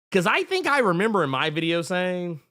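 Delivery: ordinary talk, nothing marked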